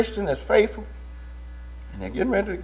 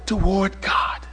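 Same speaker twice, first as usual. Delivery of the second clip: whisper; 7 dB quieter, slower than usual. Acted. Whispered close of verse.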